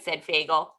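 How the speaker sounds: ordinary delivery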